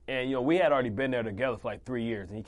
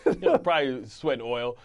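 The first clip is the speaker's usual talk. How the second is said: normal talking voice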